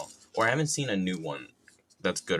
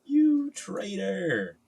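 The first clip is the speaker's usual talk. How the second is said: high, slow, drawn-out accusation; hammed-up high cry of betrayal; mock betrayed cry; 11 semitones higher, much slower than usual. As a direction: wail it slowly in mock betrayal.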